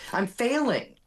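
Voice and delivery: put-on half-hearted Texas accent; attempted Texas accent. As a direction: try a Texas accent and fumble it. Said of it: "a Texas accent for you here".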